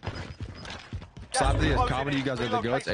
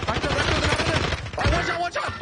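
warning yell; 12 semitones higher than usual. Real, not acted. Urgent warning callout.

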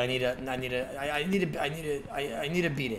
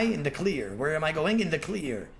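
ordinary talk, nothing marked

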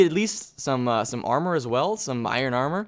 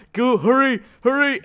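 frantic hurry; 10 semitones higher, 6 dB louder than usual. Real, not acted. Urgent rush before fight.

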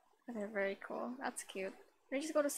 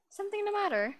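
character voice; 9 semitones higher, 4 dB louder than usual. Acted. Voicing the game character.

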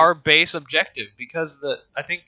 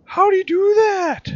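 very high-pitched astonishment; amazed outcry; 4 dB louder than usual. Real, not acted. Player amazed by friend's melee torp, high astonished cry. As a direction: squeal it in disbelief.

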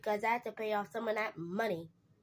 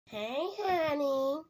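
sweet character voice; 4 semitones higher, much slower than usual. Acted. New character greets sweetly.

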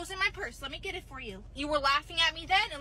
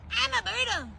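higher odd little creature croak; creepy creature voice; 7 semitones higher, 4 dB louder than usual. Acted. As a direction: speak like a weird tiny critter. Strange creature speaks.

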